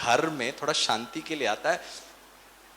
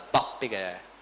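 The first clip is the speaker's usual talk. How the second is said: mother's jab; 9 semitones lower, 4 dB quieter than usual. Retold or imitated. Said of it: "mummy का dialogue होता है".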